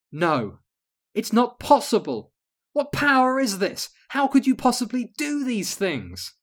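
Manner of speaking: high, loud stricken cry; horrified at defeat; desperate disbelief. Acted. Queen falls to her knees.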